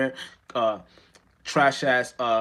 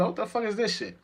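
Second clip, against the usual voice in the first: disgusted; 8 semitones higher, 4 dB quieter than usual. Retold or imitated. Retold disgusted reaction.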